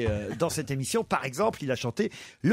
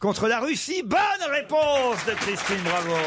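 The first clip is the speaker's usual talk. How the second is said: booming prize announcer; 7 semitones higher than usual. Acted. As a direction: announce the right answer with a flourish. Quiz host confirms answer. (annonceur de jeu tonitruant)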